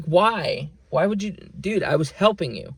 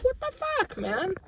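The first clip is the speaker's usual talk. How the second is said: high squeaked what the fuck, softer; stunned falsetto, almost under the breath; disbelief; 7 dB quieter than usual. Real, not acted. Venting about coworkers ignoring him, squeaky disbelief. Repeats incredulous outburst.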